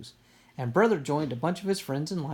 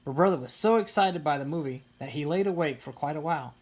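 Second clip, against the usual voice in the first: usual speaking voice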